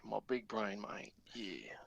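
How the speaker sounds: lightly put-on Australian accent, slow. Bragging to a friend about his big brain, lightly Australian. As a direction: drawl it out like a lightly played Aussie bloke. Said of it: "your Australian accent is".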